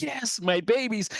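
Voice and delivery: high-pitched doting mother; fond, high mother-hen voice; delighted mother hen. Acted. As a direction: gush over your little ones, pitched high.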